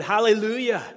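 loud, high hallelujah cry; ringing hallelujah. Acted. Preacher reading the multitude's cry, loud and ringing. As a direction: shout it out like heaven's crowd. Heavenly crowd shouting, read.

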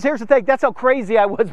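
ordinary talk

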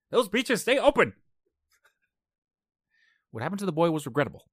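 loud, high, urgent hero; movie hero shouting. Pretend. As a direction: bark the disaster-movie warning. Acting Dante's Peak movie scene.

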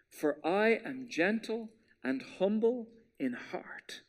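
slow whisper on the key line; hushed slow scripture whisper. Shown as a demonstration. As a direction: drop to a whisper for emphasis. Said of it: "Listen to it carefully".

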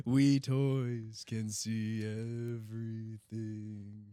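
very slow eerie voice; spooky toy voice. Pretend. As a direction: drag it out creepily.

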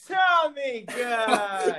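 high, slow, gleeful Tommy gun; excited shout. Real, not acted. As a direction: blurt it out with glee. Excited joke answer shouted.

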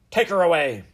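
loud, high royal command; imperious order. Acted. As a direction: shout the order to the guards. Queen orders guards to seize Eliza.

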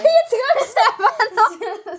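high laugh through the words; laughing while speaking. Real, not acted. Laughing at friend burping.

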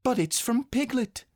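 put-on high, childlike exclamation; surprised high child; crying out. A narrator's reading. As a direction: cry out the discovery in a high child's voice. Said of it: "cried Christopher Robin".

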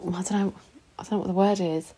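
ordinary talk, nothing marked

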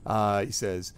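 ordinary talk, nothing marked

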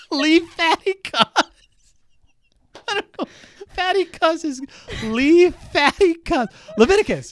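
high, loud giggly voice; tickled. Real, not acted. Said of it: "that has tickled me so much".

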